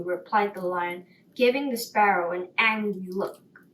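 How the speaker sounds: ordinary delivery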